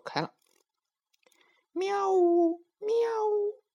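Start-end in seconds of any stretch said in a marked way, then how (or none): 1.7-3.6 high meowing like a cat. A narrator's reading. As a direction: meow like a mother cat. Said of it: "猫妈妈找小猫吃鱼去".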